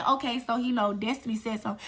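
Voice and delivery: ordinary delivery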